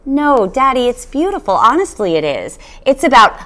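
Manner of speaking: a hint of a pleading child; lightly voiced girl. A narrator's reading. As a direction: coax him sweetly to read it. Matilda protests to father.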